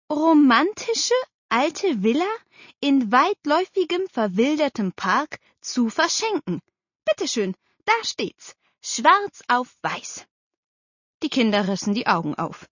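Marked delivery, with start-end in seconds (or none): 0.1-6.6 higher, deliberate reading, word by word; slow and distinct. A narrator's reading. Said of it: "wiederholte langsam und deutlich". 7.0-10.3 high, emphatic, last words drawn out; triumphant proof. A narrator's reading. Aunt proves her point.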